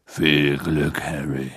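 low gentle giant; Hagrid voice. A narrator's reading. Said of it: "flüsterte Hagrid".

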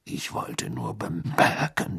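slow, low, rough rasp; gruff Moody snarl; growled. A narrator's reading. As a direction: growl it wearily, voiceless and drawn out.

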